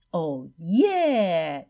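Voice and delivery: a drawn-out little cheer. Acted. Freed fish to each other, cheering. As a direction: whoop in celebration. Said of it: "鱼儿们欢呼起来".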